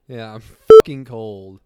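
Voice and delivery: loud, slow griping shout; loud complaint. Retold or imitated. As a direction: bellow it like you're freezing. Voicing a freezing reporter.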